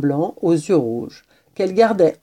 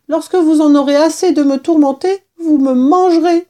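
high, louder plaintive lament; weary mouse; 9 semitones higher, 6 dB louder than usual. A narrator's reading. Mouse foretelling that the kitten will eat her, high and weary. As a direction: protest in a high, doomed little voice. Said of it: "répondit la souris d'un air las".

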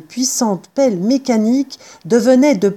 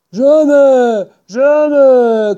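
loud, higher drawn-out cry; shouting; 5 semitones higher, 5 dB louder, much slower than usual. A narrator's reading. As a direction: cry out the refusal twice. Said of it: "cria Boggis". (cri appuyé)

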